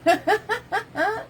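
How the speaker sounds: a high, bubbly giggle. Real, not acted. Painter giggling at her own nanny voice, high giggle. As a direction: laugh at your own silly voice. Laughs after British bit.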